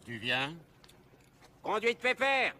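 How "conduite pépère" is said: loud, high mocking call; shouting out. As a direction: yell it across the road. Shouts mockingly at passing drivers. (crié fort, moqueur)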